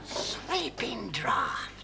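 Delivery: whisper. Acted. Caught out, playing innocent.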